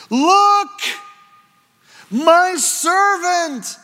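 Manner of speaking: high, loud, slow cry; ringing proclamation. Acted. Preacher proclaiming Isaiah's words to the congregation, high and loud. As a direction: cry it out across the crowd.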